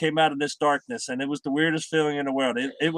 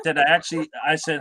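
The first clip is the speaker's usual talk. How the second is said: ordinary delivery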